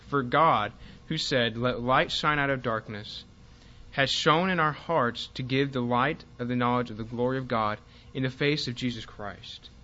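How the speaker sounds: loud, emphatic verse reading; scripture reading. Real, not acted. Teacher reading Second Corinthians to the class, emphatic scripture reading. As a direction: read the verse out firmly, phrase by phrase. Reads a Bible verse aloud.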